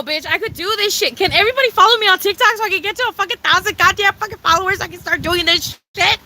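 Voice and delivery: usual speaking voice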